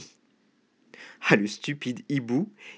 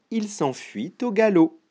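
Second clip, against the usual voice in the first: ordinary delivery, nothing marked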